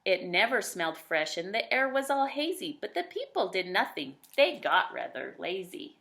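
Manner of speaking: lilting rhymed read-aloud; bouncy rhyming narrator voice; storybook narration. A narrator's reading. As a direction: tell it like a bedtime picture book.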